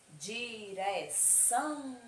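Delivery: Direção slowly, with a long-held "ção"; stress on final syllable. Shown as a demonstration. Teacher quizzing pupils on oxytones, stress on the "ção" of Direção. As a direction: stretch and lean on the end of Direção. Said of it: "Qual foi a sílaba mais forte?".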